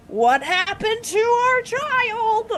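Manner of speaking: high, drawn-out mock lament; hammed-up worry, pitch climbing high; wailing clan. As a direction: wail it slowly like distraught parents. Worried clan voiced in the story.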